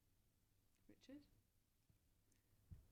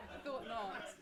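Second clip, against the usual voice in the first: laughing; 4 semitones higher, 11 dB louder, much slower than usual. Real, not acted. Host laughing at Phil's refusal.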